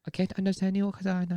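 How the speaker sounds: high mock Chinese accent, voice set apart. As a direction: put on a Chinese accent as in the story. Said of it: "Now she speaks, like".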